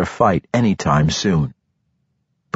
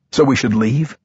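higher-pitched, accusing question; 6 semitones higher than usual. A narrator's reading. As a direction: ask it as an accusation. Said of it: "more an accusation than an honest question".

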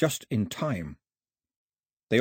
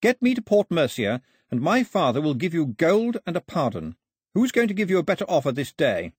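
put-on high, insistent girl's voice; high-pitched, firm negotiating; Daphne, bargaining; 7 semitones higher than usual. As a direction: press the offer on the gunmen.

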